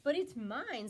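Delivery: loud, shrill scream. A narrator's reading. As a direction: shriek it possessively. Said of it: "screamed the bird".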